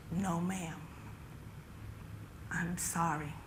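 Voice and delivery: hushed, sorrowful officer; soft-spoken. Acted. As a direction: break bad news very softly. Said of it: "soft-spoken".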